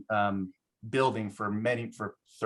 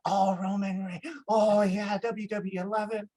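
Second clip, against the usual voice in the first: high-pitched imitation of a delighted fan; retold starstruck excitement; 8 semitones higher than usual. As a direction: act out her gushing recognition. Retold shopkeeper thrilled by wrestler.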